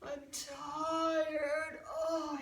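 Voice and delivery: weary, high moaning complaint; tired sigh. Acted. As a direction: groan it out exhausted. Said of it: "he sighed".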